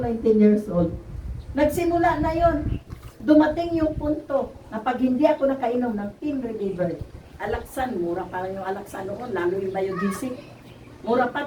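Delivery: ordinary talk, nothing marked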